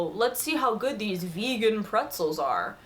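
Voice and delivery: put-on voice imitating a dubious snacker; mock skeptical. Snacker doubting an offered pretzel, mock skeptical. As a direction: lean mockingly into the quoted doubt. Re-enacts meme's snack-taker reply.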